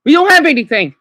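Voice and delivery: real outburst. Real, not acted. Venting to viewers about having no food, a loud despairing outburst. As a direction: burst out loud and high, real frustration.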